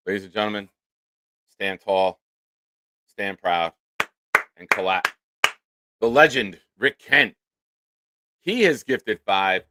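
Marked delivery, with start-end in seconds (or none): 0.1-7.3 mock-ceremonial announcer build; grand introduction. Streamer introducing a generous viewer, mock-grand announcer. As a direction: present him like royalty, a bit tongue-in-cheek.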